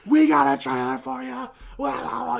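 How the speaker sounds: high, loud stage holler; imitated hype-man shout. Presenter announcing the trailer to the crowd, mock hype yell.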